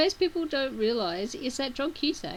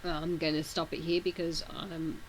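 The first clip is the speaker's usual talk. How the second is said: hoarse voice; 3 semitones lower, 5 dB quieter than usual. Real, not acted. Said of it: "losing my voice because I have a sore throat".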